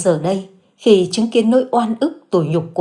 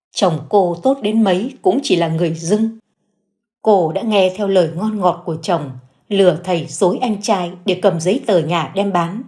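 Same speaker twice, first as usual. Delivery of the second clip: ordinary delivery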